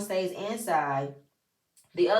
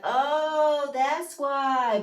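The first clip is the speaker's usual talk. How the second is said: high, louder "ohh" of dawning understanding; raised-pitch mock revelation; imitated realisation; 5 semitones higher, 4 dB louder, much slower than usual. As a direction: mimic a group suddenly getting it. Acting everybody's sudden realisation.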